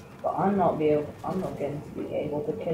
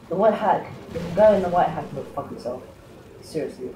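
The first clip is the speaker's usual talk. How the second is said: angry rant; faster than usual. Real, not acted. Angry outburst at enemy.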